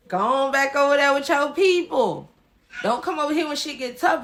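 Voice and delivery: loud dismissal. Retold or imitated. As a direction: shoo them away at full voice. Acted dismissal of gossiping neighbor.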